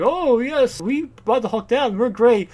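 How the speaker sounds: high, loud self-satisfied boasting; mock rich parents. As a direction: crow grandly in a society voice. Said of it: "the parents are like".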